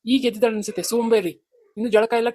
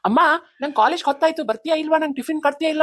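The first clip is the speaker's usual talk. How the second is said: impatient yelling; 8 semitones higher than usual. Acted. Son yells impatiently to mother.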